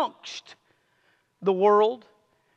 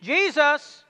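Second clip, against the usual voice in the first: raised, higher-pitched call; calling out the name; 5 semitones higher than usual. Real, not acted. Preacher prompting the congregation to answer, calling out. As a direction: say it out for the congregation to echo. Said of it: "Say it loud".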